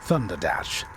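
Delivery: usual speaking voice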